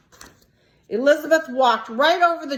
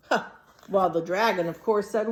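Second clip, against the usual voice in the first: usual speaking voice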